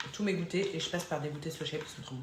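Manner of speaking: talking with a mouth full; words through a bite of snack. Real, not acted. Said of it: "je vous parle la bouche pleine".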